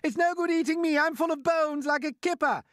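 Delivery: very high, loud pleading; panicked protest. A narrator's reading. Earthworm protests to the attacker about being eaten, in panic.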